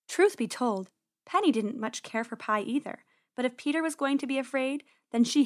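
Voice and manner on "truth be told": plain reading voice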